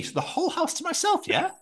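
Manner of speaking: high, thrilled question; gleefully pleased; delighted. Retold or imitated.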